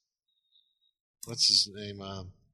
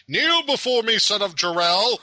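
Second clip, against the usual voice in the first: high, loud Zod impression; booming arrogant alien overlord; 11 semitones higher, 6 dB louder than usual. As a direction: boom it out like a tyrant demanding worship. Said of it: "They gotta throw in the line".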